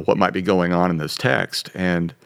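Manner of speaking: ordinary talk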